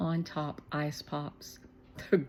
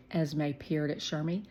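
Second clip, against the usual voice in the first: usual speaking voice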